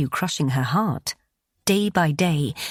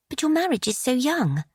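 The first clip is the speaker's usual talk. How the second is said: a mild protest in Bet's higher voice; 8 semitones higher than usual. A narrator's reading. Bet protests to Lucy in a mildly higher voice. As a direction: push back gently but insistently. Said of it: "Bet protested".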